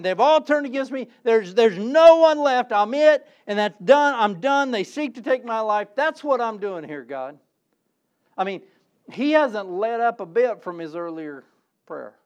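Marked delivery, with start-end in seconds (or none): 0.0-7.4 high, loud despairing complaint; loud lament, pitched up; anguished Elijah. Acted. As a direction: pour out his hopelessness to God. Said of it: "He's saying".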